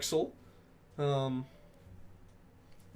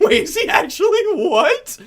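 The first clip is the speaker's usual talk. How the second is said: real burst of laughter, much higher and louder; laughing disbelief; 12 dB louder than usual. Real, not acted. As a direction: cackle in shocked disbelief at the news. Shocked laughing at chat news.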